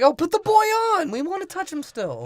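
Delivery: high put-on voice, loud at first; mock eager demand. Comic acting a pundit asking for the boy on air, high eager voice. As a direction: start loud and high, stay high. Acted leering line in retelling.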